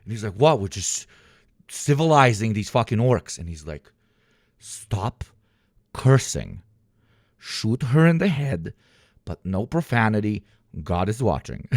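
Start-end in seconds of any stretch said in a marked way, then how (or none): 0.3-3.4 lightly voiced soldier. Soldier answers his officer. 4.6-11.7 much lower, slow deadpan commander; mock pious officer. Officer voiced, pious order.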